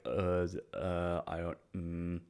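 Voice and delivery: soft, faltering imitation of lost words; halting, trailing mumble; struggling to speak. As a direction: fumble quietly for words, as if forgetting speech. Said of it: "hardly remember how to speak".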